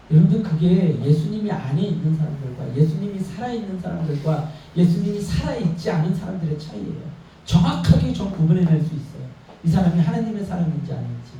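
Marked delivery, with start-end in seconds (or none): none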